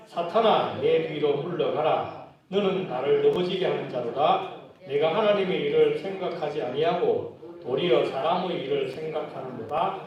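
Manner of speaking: solemn Bible reading in unison; scripture reading. Acted. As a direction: read the verse aloud together, slowly. Congregation reads scripture aloud.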